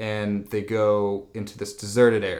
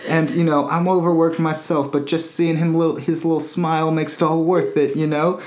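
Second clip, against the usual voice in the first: higher, louder pleading voice through laughter; mock pleading shrimp; 6 semitones higher, 5 dB louder than usual. Co-host joins the shrimp's sob story, higher pitched and laughing.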